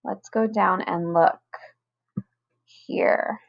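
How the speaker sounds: ordinary talk, nothing marked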